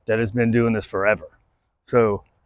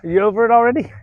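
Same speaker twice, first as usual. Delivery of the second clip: teasing dog; 9 semitones higher, faster than usual. Real, not acted.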